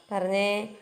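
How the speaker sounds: normal talking voice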